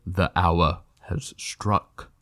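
slow, hushed voice of doom; solemn pronouncement. A narrator's reading. Aslan cuts off the prince's rant, solemn and slow.

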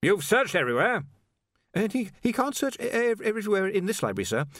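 high, stammering anxious scholar; a jittery young wizard stammering high; nervous wizard. A narrator's reading. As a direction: stumble over every word, flustered. Wizard voiced in audiobook.

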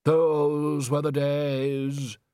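very slow, drawn-out wistful sigh of words; sad. A narrator's reading. Undershaft pining for old opera, sadly. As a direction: mourn the past, stretching every word. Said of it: "said Undershaft sadly".

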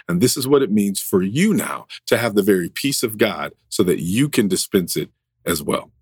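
ordinary talk, nothing marked

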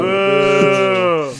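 loud jeering boo; mock boo. Booing the friend's joke in loud mock jeering. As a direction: heckle the joke-teller playfully.

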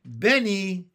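high, loud name call; sudden call. A narrator's reading. Sister suddenly calling her little brother, a sudden call. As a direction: call out to get his attention. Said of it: "Jessie said suddenly".

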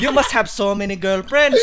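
put-on loud Filipino accent, "girlfriends" with P for F; Filipino auntie. Tita teasing a boy about girlfriends, loud Filipino accent. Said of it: "we can't say our Fs".

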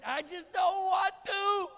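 a stubborn heart yelling its refusal; high, drawn-out cry of I don't want to; defiant outburst. Acted. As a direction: shout it high and slow, refusing. Worshipper confessing stubborn refusal.

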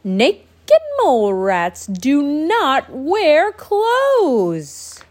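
loud, slow shout. Acted. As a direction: shout it at him, word by word. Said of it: "they're shouting at him".

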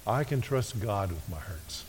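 softly restated; hushed affirmation; gentle, low reminder; quiet recap. Shown as a demonstration. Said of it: "quiet recap".

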